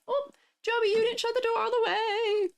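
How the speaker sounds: a high, far-off call to another room. Real, not acted. Streamer calling to Joby about the open door, calling out. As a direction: pitch it up to reach him down the hall.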